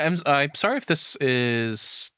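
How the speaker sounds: normal talking voice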